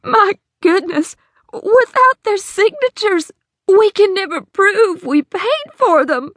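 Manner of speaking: slow, high dismay; horrified. Acted. Hattie realizes to the sheriff she can't prove payment, horrified. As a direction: let the horror dawn. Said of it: "She looked horrified".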